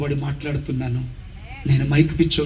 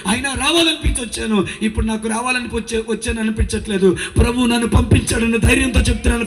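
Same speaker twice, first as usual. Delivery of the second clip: fervent preaching; 8 semitones higher than usual. Acted. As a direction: preach it up into a promise. Preaching woman pleads again.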